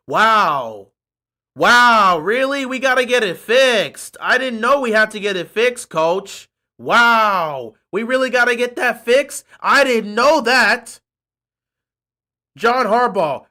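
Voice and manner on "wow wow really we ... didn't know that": loud mock surprise; sarcastic wow. Fan sarcastically mocking the coach's obvious quote. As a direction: fake shock, dripping sarcasm.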